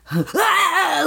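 high-pitched pretend shock cry; imitated electric-shock "ah"; mock electrocution yelp. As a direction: yelp as if zapped, playfully. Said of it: "pretending like he was electrocuted".

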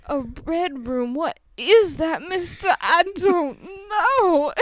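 put-on high, slow, drawn-out Southern accent; baffled Southern caricature, stretching the last word. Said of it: "I love the Southern accent".

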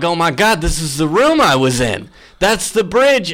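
high, rising excitement of recognition; astonished realization. Retold or imitated. Storyteller recognizing the bridge on the tour, astonished. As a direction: relive the shock as it clicks.

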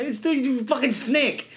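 high, drawn-out disbelief; incredulous retort. Retold or imitated. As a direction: stretch it out in baffled mock outrage. Retells his incredulous comeback.

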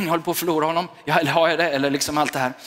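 ordinary delivery

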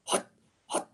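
breathy unvoiced hut hut hut; whispered huts. Shown as a demonstration. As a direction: push short whispered huts from the stomach. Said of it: "the quarterback hut method".

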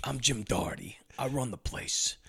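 soft, slow gruff New York narrator voice; mock noir voiceover in a bad New York accent. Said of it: "I'll try to do as bad an accent as Gary Oldman".

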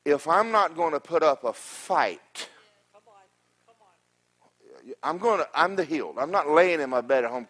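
shouting I'm the healed; preaching at full force. Real, not acted. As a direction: proclaim your healing to the congregation. Preaching climax, declaring healing.

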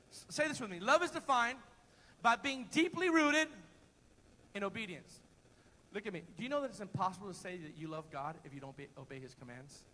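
ordinary talk

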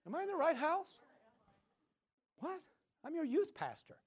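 high, incredulous pitch; a touch of mock outrage; shocked disbelief. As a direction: sound stunned and baffled. His shocked reaction.

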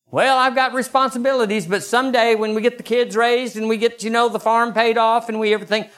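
high, fast whiny excuse; squeaky rambling excuse; mock excuse. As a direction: mimic the procrastinating parent. Mocking someday excuse.

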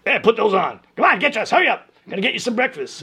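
hurrying teacher. Retold or imitated. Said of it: "And it was".

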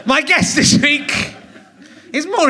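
slow, high, shouted guest announcement; booming show host welcoming the guest; big host intro. Acted. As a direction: stretch it out like a hyped emcee.

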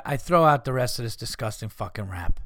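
ordinary delivery

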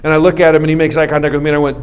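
ordinary talk, nothing marked

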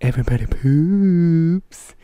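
put-on podcast host greeting, drawn out; over-polished, insincere show opener; fake-sounding. Host opens the podcast for listeners, fake-sounding. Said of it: "You sound so fake".

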